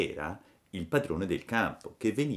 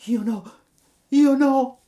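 high, loud sobbing protest; sobbing cry; 13 dB louder, slower than usual. A narrator's reading. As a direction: cry out in tears. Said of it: "gridò Pinocchio singhiozzando".

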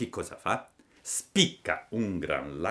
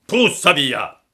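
loud, high menacing bellow; threatening shout; 8 semitones higher, 11 dB louder, slower than usual. A narrator's reading. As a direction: shout it to scare off a dog. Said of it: "gli gridò il pescatore minacciandolo". (urlo minaccioso)

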